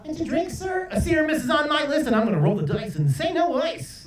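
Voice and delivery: high-pitched rhyming DJ patter; radio DJ voice. Acted. DJ rhyming his drink order at drive-thru, hammed-up radio voice. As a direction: turn a drink order into on-air hype. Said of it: "They really talk like that".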